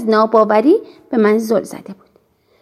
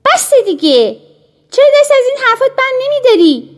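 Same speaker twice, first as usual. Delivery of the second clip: high, fast angry outburst; girl losing her temper at a pushy friend; 12 semitones higher, 4 dB louder than usual. A narrator's reading. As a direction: snap back, fed up and rushing. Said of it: "حالا واقعاً عصبانی شده بودم".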